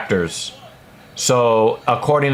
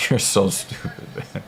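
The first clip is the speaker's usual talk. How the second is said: laughing; 4 semitones lower, 7 dB quieter than usual. Real, not acted. Laughing at a dumb comment.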